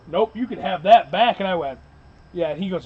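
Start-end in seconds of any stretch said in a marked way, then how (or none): 0.1-1.4 louder, handing it straight back; mock hurt refusal. Newcomer returns the gun after the kick, loud mock refusal. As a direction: say it fast, wanting none of it. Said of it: "he grabs it".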